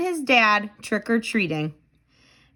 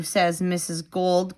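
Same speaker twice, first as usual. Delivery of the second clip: ordinary delivery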